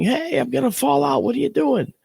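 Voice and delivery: high panicked yell; high alarmed shout from the back of the car; retold yell. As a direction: cry out in a high voice, scared of falling. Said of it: "And I'm yelling".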